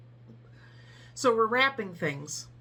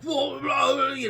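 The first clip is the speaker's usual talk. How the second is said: grumbling customer; 6 semitones higher, 6 dB louder than usual. Retold or imitated. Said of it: "he's like".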